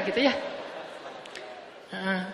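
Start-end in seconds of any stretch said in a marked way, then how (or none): none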